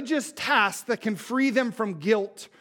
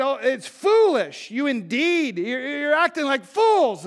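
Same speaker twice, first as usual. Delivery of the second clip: louder, slower mock rebuke; mock scolding; 8 semitones higher, 8 dB louder than usual. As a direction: scold them like a let-down teacher. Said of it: "he just did it".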